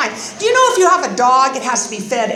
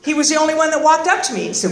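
ordinary talk

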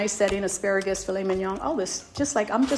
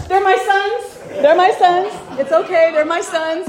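higher, louder, fast happy exclaiming; excited relief; 9 semitones higher, 8 dB louder than usual. Retold or imitated. Mother telling the crowd the Grinch is her son, excited. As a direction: blurt it out to everyone in relief.

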